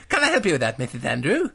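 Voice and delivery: a lightly voiced schoolboy; little boy John. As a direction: play the good little boy.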